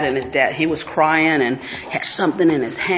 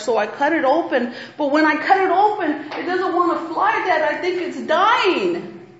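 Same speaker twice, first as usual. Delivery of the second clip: put-on higher, childlike voice, fretting; worried little boy; 8 semitones higher than usual. Little boy confessing to his dad, higher and anxious. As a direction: plead like a small boy who broke something.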